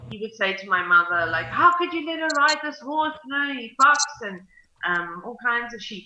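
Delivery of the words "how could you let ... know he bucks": higher, louder scolding question; indignant reproach. Retold or imitated. He scolds the mother about the horse, indignant. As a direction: voice an indignant complaint.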